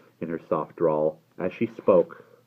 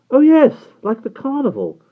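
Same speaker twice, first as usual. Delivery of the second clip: put-on very high, loud dismissive boy's reply; 13 dB louder than usual. A narrator's reading. Barney likening the custom to a carnival, dismissively. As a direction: brush it aside in a high, loud voice. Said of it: "Barney said dismissively".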